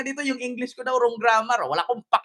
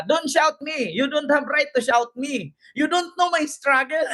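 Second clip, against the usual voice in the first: mock protester; 4 semitones higher than usual. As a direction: mimic her protest, rising in pitch. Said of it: "Pareho kami ni ate mag-English". (panggagaya sa babaeng nagrereklamo)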